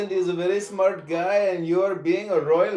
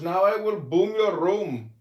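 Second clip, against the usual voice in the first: normal talking voice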